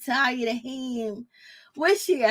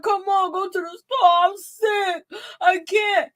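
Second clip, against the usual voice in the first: higher, pleading sick-man whine; whiny sick husband; 7 semitones higher, 3 dB louder than usual. Retold or imitated. Husband begging wife to go to the store, higher whiny plea. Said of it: "he was like".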